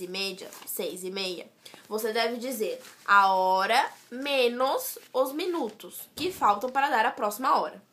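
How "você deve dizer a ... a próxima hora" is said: normal talking voice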